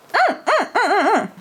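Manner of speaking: high, excited "mm" hums of pleasure; delighted humming. Real, not acted. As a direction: savour it with rising little hums. Delighted by the scent.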